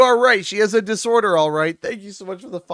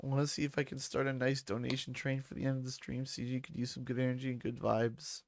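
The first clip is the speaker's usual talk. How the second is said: low, soft, quick murmur; hushed and low; quiet re-reading; 6 semitones lower, 12 dB quieter, faster than usual. Real, not acted. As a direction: read it softly to yourself. Re-reading donor's message, getting emotional.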